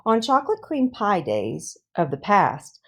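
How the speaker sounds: ordinary delivery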